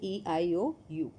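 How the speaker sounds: ordinary talk, nothing marked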